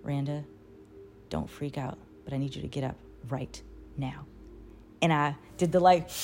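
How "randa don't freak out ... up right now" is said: low, hushed voice close to the ear; retold whisper. Waking a sleeper in the tent, imitated whisper. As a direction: softly wake someone without startling them. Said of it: "what I heard in my ear was a whisper".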